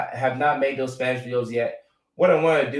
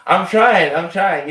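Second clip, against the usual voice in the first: playful protest; 8 semitones higher, 10 dB louder, slower than usual. Real, not acted. Defending his Spanish accent, laughing.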